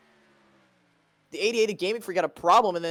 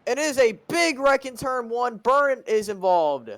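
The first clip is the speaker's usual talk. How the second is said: high, loud rushed play-by-play; excited commentary; 7 semitones higher, 4 dB louder than usual. Real, not acted. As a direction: call the wreck live. Announcing big wreck live.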